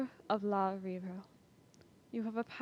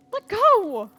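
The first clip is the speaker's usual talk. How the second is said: much higher, much louder yell of "let go"; struggling yell; 11 semitones higher, 11 dB louder than usual. Acted. Son fighting off the slavers' grip, loud yell. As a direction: wrench free and yell.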